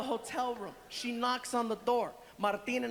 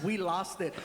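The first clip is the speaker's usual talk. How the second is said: normal talking voice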